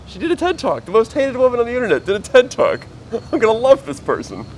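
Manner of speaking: high, excited squeal of a retold thought; thrilled disbelief. Telling own reaction to finding the TED Talk, thrilled. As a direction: burst out high and loud, breaking into a laugh.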